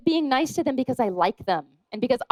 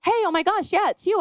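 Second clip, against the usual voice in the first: excited greeting; 8 semitones higher than usual. Retold or imitated. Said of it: "it was just saying".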